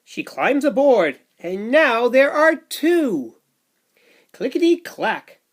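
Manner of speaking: put-on lively read-aloud for little kids; sing-song storybook rhythm; bouncy picture book narration; reading to children.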